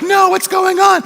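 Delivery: high, drawn-out mock panic; freaking out. Disciples baffled by the empty tomb, freaking out. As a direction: cry it out in comic confusion. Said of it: "they freak out".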